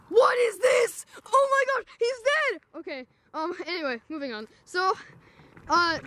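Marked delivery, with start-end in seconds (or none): 0.1-2.6 high shocked cry at a body; shocked shout. Acted.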